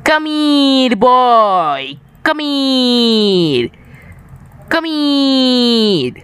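high, sing-song, drawn-out come here; creepy lure. Pretend. Player luring his teammate Mokil, creepy sing-song coaxing. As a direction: coax him like a creepy stranger.

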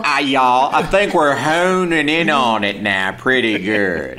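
slow, drawled caricature American accent; regional American accent. Shown as a demonstration. Australian honing the accent for co-hosts, exaggerated American accent. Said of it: "they do regional accents".

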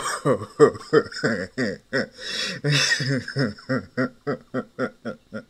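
long burst of giggling laughter; helpless laughter. Acted. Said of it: "what's so funny?".

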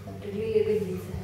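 usual speaking voice

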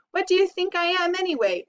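fast, high-pitched exasperated question; indignant outburst. A narrator's reading. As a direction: burst out as a fed-up rat. Rat indignantly refuses more errands.

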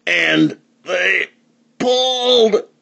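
high, halting words, as if heaving; effortful, climbing pitch; straining storytelling. A narrator's reading. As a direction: break it up and heave on "pulled".

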